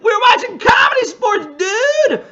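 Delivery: big gleeful yell to a hungover neighbor; excited shout. Pretend. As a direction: shout it high and loud. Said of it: "you can be like".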